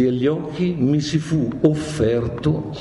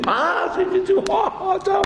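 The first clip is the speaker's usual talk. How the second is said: much higher, excited mock hail; surprised greeting. Dante hailing Virgil with surprise, high and excited. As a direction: greet him like a long-lost friend, way up high.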